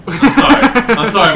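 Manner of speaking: loud laughing sorry; laughing apology. Real, not acted. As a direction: blurt the apology through laughter.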